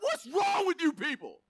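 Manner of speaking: high-pitched comic exasperation; exasperated scolding. Acted. Preacher playing a fed-up God to the people, exasperated scolding. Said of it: "That's how I would say it".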